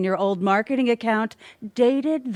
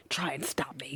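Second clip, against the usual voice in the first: a menacing whisper; whispered taunt; 6 semitones lower, 15 dB quieter, faster than usual. Acted. Villain whispering a dare to the agent. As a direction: breathe the dare out low.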